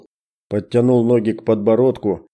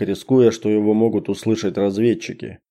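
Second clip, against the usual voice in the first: plain reading voice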